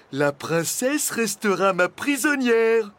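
high, loud, slowed condition; pointed warning. A narrator's reading. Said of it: "précisa Flamèche". (avertissement appuyé, voix haute)

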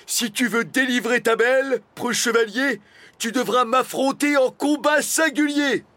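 loud, high mock-heroic challenge; trying to be menacing. A narrator's reading. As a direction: declaim a threat you can barely pull off. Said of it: "s'efforça de paraître menaçant". (menace forcée, déclamée haut et fort)

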